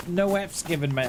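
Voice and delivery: ordinary talk, nothing marked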